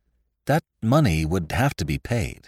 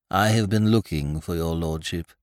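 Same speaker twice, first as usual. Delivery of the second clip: soft, deferential butler; hushed genteel host; 4 semitones lower, 4 dB quieter than usual. Acted. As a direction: speak low like a discreet butler. Butler addresses the lord.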